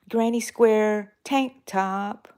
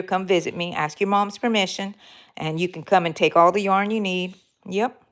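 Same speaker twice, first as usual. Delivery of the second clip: normal talking voice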